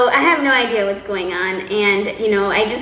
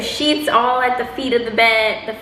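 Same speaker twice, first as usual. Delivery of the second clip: normal talking voice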